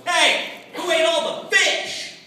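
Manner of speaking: high, slow mock-accusing call; fishing buddy. Fishing buddies returning from a smoke break, mock accusing. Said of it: "They come back and go".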